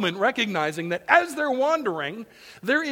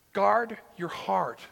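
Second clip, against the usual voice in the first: slow and deliberate; emphatic repetition; slower than usual. Shown as a demonstration. Preacher cueing attention, slow and deliberate. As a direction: drive it home slowly. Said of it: "Listen up".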